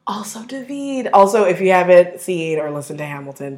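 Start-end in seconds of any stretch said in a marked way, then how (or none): 0.1-1.1 soft, breathy whisper; whispered aside. Real, not acted. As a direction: slip the name in under your breath. Swooning aside adding a name.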